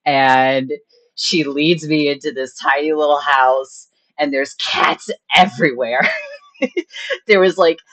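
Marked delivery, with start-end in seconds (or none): none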